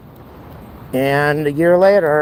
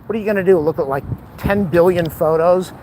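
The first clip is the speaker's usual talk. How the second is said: ordinary talk, nothing marked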